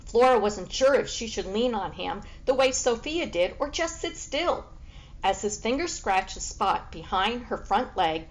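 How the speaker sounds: ordinary delivery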